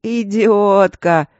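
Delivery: loud, slow «Идиотка» stamped down; angry shout. A narrator's reading. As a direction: hammer «Идиотка» slowly and loud. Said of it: "припечатал он снова".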